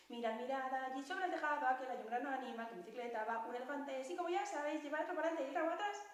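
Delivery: rushed, racing to the end. Pretend. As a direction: gabble it like a hyper squirrel. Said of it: "le ha salido más rápido todavía".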